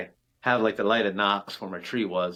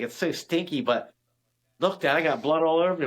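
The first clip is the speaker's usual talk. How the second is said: imitating daughter; 7 semitones higher than usual. Daughter gutting the deer.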